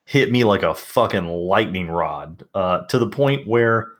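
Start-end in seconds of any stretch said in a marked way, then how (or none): none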